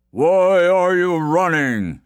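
big, slow, loud giant voice; huge giant. A narrator's reading. Forest giant questions the fleeing man, loud slow giant. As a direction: boom it out slowly as a giant. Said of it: "asked the huge fellow".

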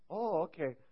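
lightly imitating an Indian accent. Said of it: "I might do an Indian accent here".

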